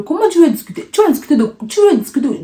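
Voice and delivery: incredulous inner scoff. Retold or imitated. Inner voice mocking his sister's wish to talk to the bandit, high incredulous scoff. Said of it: "Dans mon cœur, il me dit".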